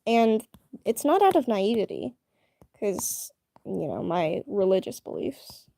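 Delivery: normal talking voice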